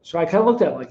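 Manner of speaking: ordinary talk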